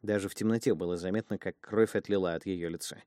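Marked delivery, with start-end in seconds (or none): none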